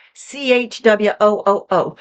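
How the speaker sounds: tight-throated rushing chwooo; throaty chwooo sound. Shown as a demonstration. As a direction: breathe out a throaty snore-like chwooo. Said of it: "You tighten your throat to make that C H sound".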